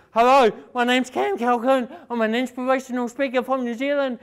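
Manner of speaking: rushed, fast self-introduction; too fast. Retold or imitated. Nervous caller racing through his phone intro, fast. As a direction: rattle off the intro at speed. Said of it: "You speak fast".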